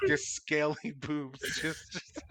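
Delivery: breaking up with laughter mid-phrase; slow words dissolving into high giggles; laughing. Real, not acted. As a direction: try to talk through real laughter. Cracking up at own joke.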